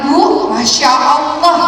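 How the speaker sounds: stunned happy gasp of praise; joyful amazement. Retold or imitated. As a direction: relive seeing your child walk. Video of her child walking.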